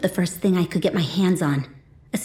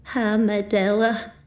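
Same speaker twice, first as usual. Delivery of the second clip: slow, tearful mother's voice; 3 semitones higher, slower than usual. A narrator's reading. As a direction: speak through tears, slowly. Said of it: "my mom's tearful voice".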